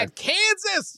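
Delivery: high, slow mocking squeal; mock high exclamation. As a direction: squeal it in disbelief. Mocking the quote's setting.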